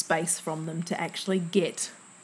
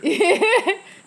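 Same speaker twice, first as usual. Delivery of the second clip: loud, high delighted laugh; high burst of laughter; 12 semitones higher, 7 dB louder than usual. Real, not acted. Laughing loudly as her co-host teases her. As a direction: let out a bright laughing burst.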